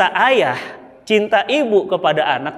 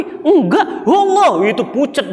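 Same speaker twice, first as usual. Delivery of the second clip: a touch of retold panic, higher and louder; panicked reply; 7 semitones higher, 3 dB louder, slower than usual. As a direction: recall the fear of the lost child. (sedikit panik, suara lebih tinggi)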